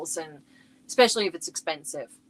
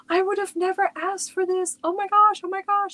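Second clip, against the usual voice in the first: higher, louder gushing as the daughter; imitating excited daughter; 11 semitones higher, 5 dB louder than usual. Mom retelling her daughter opening the gift, excited. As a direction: replay her squeal of delight.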